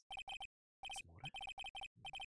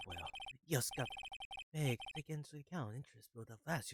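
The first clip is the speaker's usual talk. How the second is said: ordinary talk, nothing marked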